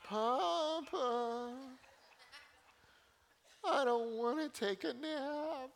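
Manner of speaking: put-on high, drawn-out whiny little girl; high nasal toddler whine of Papa. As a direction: whine it like a tired three-year-old. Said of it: "take whining to an art form".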